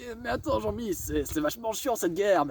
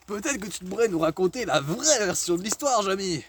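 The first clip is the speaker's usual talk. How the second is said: ordinary talk, nothing marked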